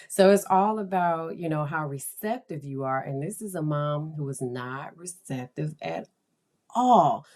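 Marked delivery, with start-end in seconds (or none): none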